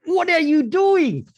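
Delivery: high, loud yell of disbelief; exasperated outburst. Acted. Soldier grabs his head at the dog, exasperated outburst. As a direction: burst out loudly, pitch shooting up. Acted outburst breaks slow-motion movie scene.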